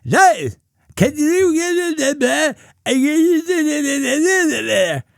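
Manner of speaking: high, slow, whining mock deaf voice; deaf accent. Cashier asking Dave for something, slow high mimicry. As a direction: stretch it out high and blurred. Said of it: "she said".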